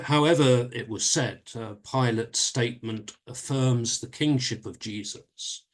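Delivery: ordinary talk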